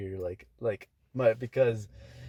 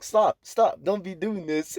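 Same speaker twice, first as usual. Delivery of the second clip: higher, louder urgent stop; mock manager shout; 8 semitones higher, 5 dB louder, slower than usual. Manager stopping the fight, loud and high. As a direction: call it out like breaking up a fight.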